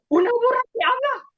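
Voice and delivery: stunned disbelief; astonished. Acted. Bilam amazed at the talking donkey, high astonished. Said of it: "se quedó con la boca abierta".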